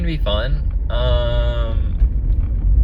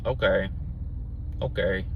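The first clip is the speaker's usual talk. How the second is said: unimpressed okay; 5 semitones lower, 6 dB quieter than usual. Retold or imitated. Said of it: "And I'm like".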